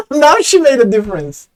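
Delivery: laugh, then a loud, high protest; incredulous laughing complaint; amused outrage. Real, not acted.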